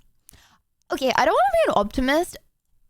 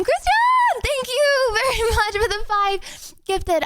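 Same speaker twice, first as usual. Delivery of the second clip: excited high thank-you; 10 semitones higher, slower than usual. Real, not acted. Thanking a viewer for gifted subs.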